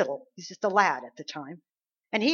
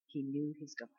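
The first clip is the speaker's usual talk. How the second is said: very soft, low answer; hushed revelation; 5 semitones lower, 14 dB quieter than usual. Real, not acted. Preacher answering what made David different, hushed. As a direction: drop right down, almost confiding.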